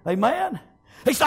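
normal talking voice

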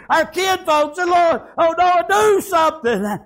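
high, drawn-out plea; preacher acting a frantic wife; desperate pleading. Noah's wife pleads for the drowning.

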